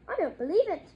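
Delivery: loud scoffing disbelief; scornful disbelief. A narrator's reading. Disbelieving relative retorts.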